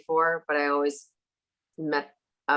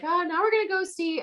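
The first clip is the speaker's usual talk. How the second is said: chirpy tour guide; 11 semitones higher than usual. Retold or imitated. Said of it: "the tour guide was like".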